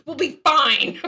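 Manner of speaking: fast, high, loud mock pep talk; excited quote. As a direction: rattle it off brightly, laughing through it. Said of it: "I'm like".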